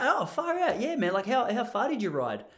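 high-pitched, impressed exclamation; amazed reaction. Retold or imitated. Acting out his impressed reaction.

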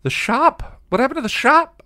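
very high, loud shriek; horrified high-pitched scream. A narrator's reading. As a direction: shriek it in outraged panic. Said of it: "Anya screamed".

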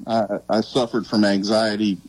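ordinary talk

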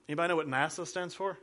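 normal talking voice

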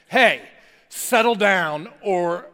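loud stern warning, slowing on settle down; shouted dad warning; dad yelling down the stairs. Retold or imitated. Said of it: "like that voice".

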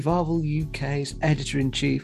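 bright, announcer-style welcome and plug for subscribers; upbeat radio-style podcast introduction; podcast intro read. Acted. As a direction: sell the show like a host's opening spiel.

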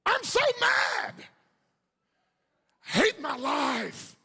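high, drawn-out angry yell; hammed-up, slow, furious outburst; loud complainer. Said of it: "A complainer is like".

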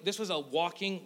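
usual speaking voice

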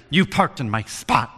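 mock-indignant accusation, last word punched; put-on frustrated complaint; imitated frustrated woman. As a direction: imitate an annoyed stranger confronting you. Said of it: "And she's frustrated".

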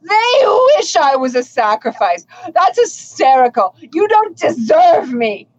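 high, slow, grandly indignant; hammy stage actor. Acted. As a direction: overplay the outraged noble. Captured noble scoffs theatrically.

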